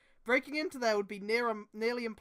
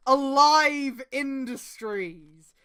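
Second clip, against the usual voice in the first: mock announcer; 2 semitones higher, 10 dB louder, much slower than usual. Announcer-style read of company name.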